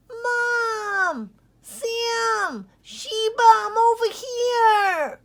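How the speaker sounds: very high, loud calling back; joyful shout. Acted. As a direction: shout to family across the field. Pup calls back to family.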